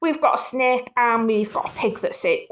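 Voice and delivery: plain reading voice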